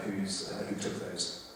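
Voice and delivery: usual speaking voice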